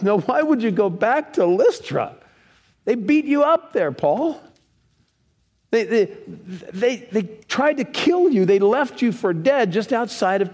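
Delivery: incredulous questioning. Pretend. Preacher addresses Paul directly.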